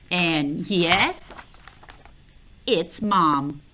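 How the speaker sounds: ordinary delivery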